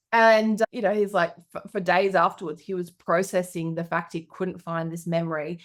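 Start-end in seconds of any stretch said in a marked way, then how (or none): none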